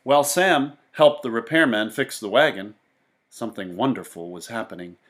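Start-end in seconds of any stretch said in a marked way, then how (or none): none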